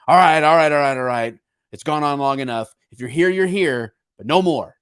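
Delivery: loud, high exasperated mock proclamation; fed-up politicians. Politicians loudly declaring the border closed, fed up. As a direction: bellow it like a leader finally losing patience. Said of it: "I think maybe they're like".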